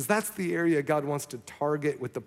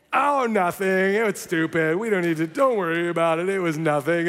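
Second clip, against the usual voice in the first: flustered brush-off; 2 semitones higher, 5 dB louder than usual. Retold or imitated. Pastor to staffer, hastily dropping the layoff topic. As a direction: wave it off too quickly.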